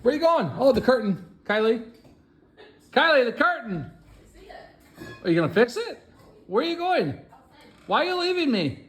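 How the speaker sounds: high-pitched mock abandonment; plaintive calling after. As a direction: call after someone who is walking off. Calling after Kylie as she leaves.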